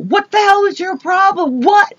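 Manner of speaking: high-pitched, indignant comeback; indignant challenge. Retold or imitated. Teller replays her mouthy reply to the man, indignant. Retold angry outburst at the man.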